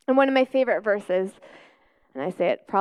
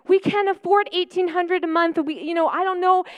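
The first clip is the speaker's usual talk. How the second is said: higher, anxious pleading; panicked worry; 7 semitones higher than usual. Retold or imitated. Mother panics to God about rent she cannot afford. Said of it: "and I'm like".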